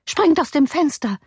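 high-pitched panicky urging; urgent command. A narrator's reading. Nurse pushing master to escape, urgent command. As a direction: urge him to escape in alarm.

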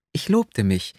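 plain reading voice

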